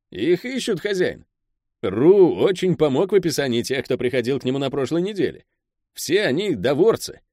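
high-pitched underling reporting to his boss; Pel, the deferential henchman. Acted.

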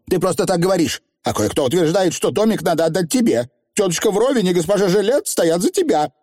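put-on high-pitched, accusing young witch; indignant rival witch; haughty young witch. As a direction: argue in a high, aggrieved character voice. Voices Anagramma, rival young witch.

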